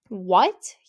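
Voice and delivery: loud, startled "what"; shocked exclamation. A narrator's reading. Friend reacting to news of the troll, shocked exclamation. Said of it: "Caitlin exclaims".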